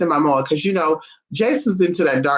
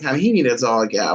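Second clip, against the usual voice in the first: ordinary talk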